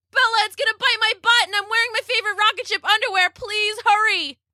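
high-pitched frantic pleading; comic storybook panic; panicked pleading. A narrator's reading. Stuck Rob begging Bella for rescue.

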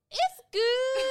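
squeaky high "good", held long; high pitch. Retold or imitated. Friend faking that all is well, high-pitched. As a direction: sing it out in a very high, stretched voice. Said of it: "The high pitch".

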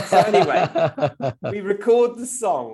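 chuckling as the story turns; laughing. Real, not acted. Laughing while telling funny story.

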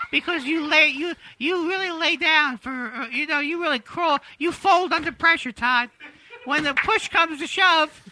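Kathy Griffin. Acted. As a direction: push the pitch up and lean on the key words. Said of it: "I demand you do the Ka-, Kathy Griffin voice".

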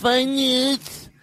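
high, put-on snobby pronunciation. Shown as a demonstration. Host showing off a director's surname, snobby. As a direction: say the name as snobbishly as possible. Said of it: "Let's say it as snobby as we can".

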